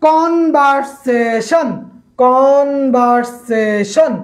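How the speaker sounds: "Conversation" stretched very slowly, each syllable held; drawn-out syllables. Shown as a demonstration. Teacher giving a polysyllable example, "conversation" syllable by syllable. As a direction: chant out "conversation" as কন-ভার-সে-শন, very slowly. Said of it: "তিনের অধিক অংশ যখন হয়".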